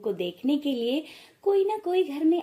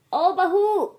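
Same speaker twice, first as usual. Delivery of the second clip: loud, high call across the house; 6 semitones higher, 6 dB louder, much slower than usual. Acted. Mother-in-law calls her daughter-in-law from across the house, loud and high. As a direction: shout for the bride from another room.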